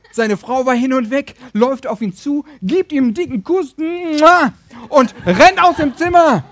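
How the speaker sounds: high, loud, building to a shout; excited storytelling. A narrator's reading. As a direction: tell her delight at full volume. Tells of wife's wild delight.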